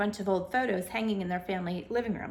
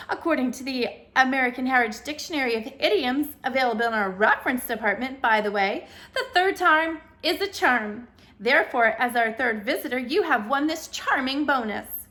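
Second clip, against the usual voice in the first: loud, higher game-show announcement; jolly booming announcer; 3 semitones higher, 7 dB louder than usual. A narrator's reading. Ceiling announcer telling Haley she's the third visitor, jolly boom. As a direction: boom it like a prize host. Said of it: "boomed the jolly announcer".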